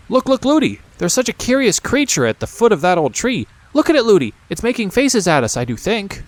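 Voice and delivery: put-on high excited little girl; eager child pulling the nurse along; princess calling. A narrator's reading.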